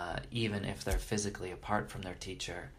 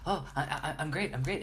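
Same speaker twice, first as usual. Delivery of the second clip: imitating a flustered, higher-pitched devotee; super excited; 6 semitones higher than usual. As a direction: stammer happily, thrilled to be called. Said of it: "they get super excited".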